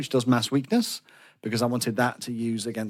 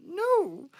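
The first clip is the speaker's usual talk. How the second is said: despairing no; 4 dB quieter than usual. Retold or imitated. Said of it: "I was like".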